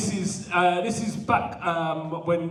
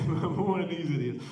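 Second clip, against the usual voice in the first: laughing repeat. Real, not acted. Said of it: "Sorry for the accent".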